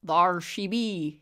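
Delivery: drawn-out fake pirate voice; fake pirate accent. A narrator's reading. Mister Tallyman pointing to the door, fake pirate accent. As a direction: announce it slowly as a hammy pirate. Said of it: "he said in a fake pirate accent".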